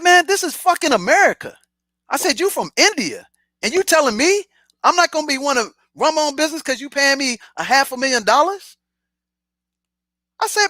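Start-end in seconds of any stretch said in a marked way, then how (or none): 0.0-8.8 retold indignant protest, pitch raised; indignant outburst. Employee to boss over running his own business, indignant. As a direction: argue back in high-pitched disbelief.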